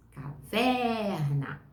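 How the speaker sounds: very slow "caverna", long stressed VER; sílaba tônica alongada. Shown as a demonstration. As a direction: stretch the middle syllable of "caverna". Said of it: "Preste atenção no pedacinho mais forte". ("caverna" bem devagar, com o "ver" esticado)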